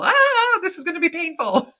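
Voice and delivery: high, loud dismayed cry; a mock pained family reaction; dismayed groan. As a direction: wince out loud at bad news. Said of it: "makes people go".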